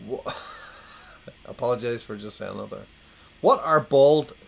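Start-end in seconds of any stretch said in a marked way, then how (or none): 3.4-4.3 loud, slow, put-on posh accent. Joke teller announcing a bald captains gag, in posh voice. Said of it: "I'm doing my posh voice now".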